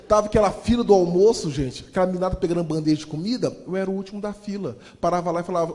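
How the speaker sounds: ordinary talk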